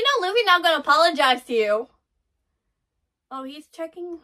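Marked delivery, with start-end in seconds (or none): none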